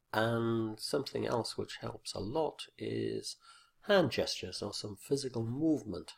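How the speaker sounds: normal talking voice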